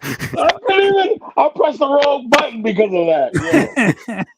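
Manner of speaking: high, slow words through laughter; laughing while talking. Real, not acted.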